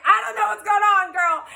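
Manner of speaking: fast, high, frantic; panicked. Retold or imitated. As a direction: rush it out in a panic. Panicked on phone, retold.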